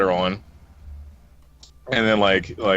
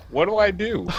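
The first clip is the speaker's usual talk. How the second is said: higher, flustered inner panic; panicked inner voice; 9 semitones higher than usual. Retold or imitated. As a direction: play his helpless inner thought, pitched up. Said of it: "Chris in his head was just like".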